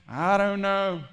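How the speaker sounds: drawn-out, doubtful group voice; mock uncertainty. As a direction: imitate people dithering, stretch it out. Everybody else wavering, voiced by preacher.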